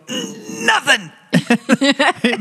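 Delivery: put-on child caught red-handed, feigning innocence; innocent toddler. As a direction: play a guilty little kid denying it. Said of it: "He'd go".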